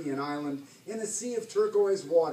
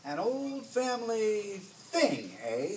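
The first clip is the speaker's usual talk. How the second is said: higher, slow, drawn-out pirate echo; suspicious echo; 5 semitones higher, slower than usual. A narrator's reading. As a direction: draw the words out slowly, probing her story. Hook echoes her words suspiciously.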